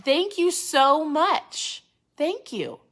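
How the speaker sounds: high, loud, slowly stretched gratitude; lively thank you. Shown as a demonstration. Host showing viewers a lively thank you, bright and excited. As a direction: pour real delight into the thanks. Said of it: "Life up your thank yous".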